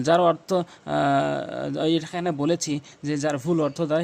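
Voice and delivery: normal talking voice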